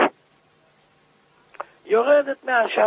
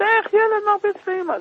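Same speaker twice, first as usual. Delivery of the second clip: crying; 10 semitones higher than usual. Retold or imitated. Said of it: "התחיל לבכות".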